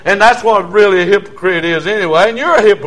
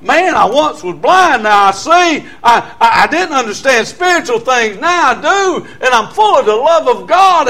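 rising, louder, overjoyed; higher and louder, full of wonder; joyful testimony; 6 semitones higher, 4 dB louder than usual. Retold or imitated. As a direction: lift it up high with new-found joy. His joyful thoughts after salvation.

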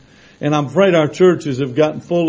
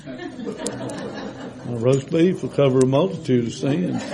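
laughing; 7 dB quieter, slower than usual. Real, not acted. Joke with laughter.

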